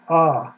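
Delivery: ah, open and held; vowel ah. Shown as a demonstration. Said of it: "A vowel".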